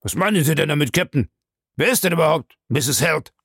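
high-pitched indignant character voice; protesting suspect. Acted. Suspect challenging the captain's accusation, in protest.

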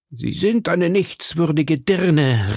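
höher und langsam, donnernd anklagend; Donnerstimme. A narrator's reading. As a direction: schleudere ihr die Beschimpfung entgegen. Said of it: "rief er mit Donnerstimme". (donnernd, hoch und langsam)

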